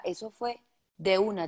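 usual speaking voice

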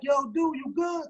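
high, called-out check-in; calling out. Retold or imitated. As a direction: shout up the street to a friend. Friend calling out running up.

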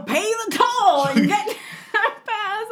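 high, loud, drawn-out croaked demand; hammed-up toll collector, half laughing; mock toll keeper. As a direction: call it out slowly like a comic gatekeeper.

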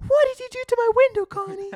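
hammed-up outrage. Bart yelling at Connie over his smashed window, loud, high and fast. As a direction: blast it at her in full comic fury. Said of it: "He's like".

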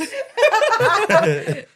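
real high giggle; high laugh. Real, not acted. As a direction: burst of high-pitched laughter. Laughing at the egg joke.

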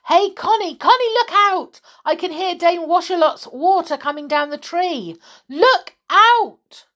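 high, loud warning shout; urgent yell. A narrator's reading. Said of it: "he yelled loudly to Connie".

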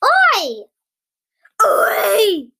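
high, loud indignant shout; roaring. Acted. Giant hit on the nose wakes, roaring. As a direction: roar it out like a woken giant. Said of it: "roared the giant".

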